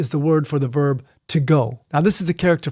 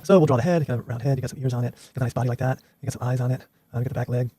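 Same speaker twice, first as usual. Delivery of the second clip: much softer and lower, talking to himself as he sketches; soft running commentary while drawing a horse; quiet drawing commentary; 4 semitones lower, 6 dB quieter, faster than usual. Real, not acted. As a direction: murmur along with the pencil strokes.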